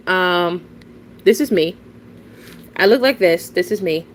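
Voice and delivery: usual speaking voice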